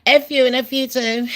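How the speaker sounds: [name] swearing at the press, high and mocking. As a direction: fling it off as a hammed-up [name]. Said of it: "[name]'s like".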